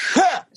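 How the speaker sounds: put-on very high, short old man's huff; high-pitched mock scoff; imitated scornful huff. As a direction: mimic a dismissive old man's grunt.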